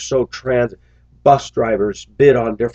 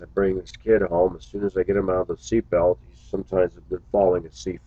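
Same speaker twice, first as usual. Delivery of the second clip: ordinary talk, nothing marked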